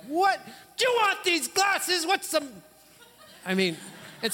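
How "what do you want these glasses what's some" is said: much higher, louder outraged shout at the ref; mock angry yell. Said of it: "you get a little bit more aggravated".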